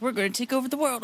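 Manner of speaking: high-pitched mocking quote; mock boast. Mocking what "you guys" said.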